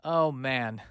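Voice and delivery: ordinary delivery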